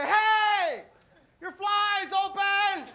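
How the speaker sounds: high, loud, slow shout with a laugh; shouting at the groom mid-ceremony; yelled. Pretend. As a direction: holler it at the groom. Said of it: "you say".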